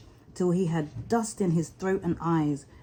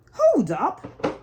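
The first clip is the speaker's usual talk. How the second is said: put-on elderly rabbit; 8 semitones higher than usual. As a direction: stop him like a fussy old rabbit. Elderly rabbit halts the mole.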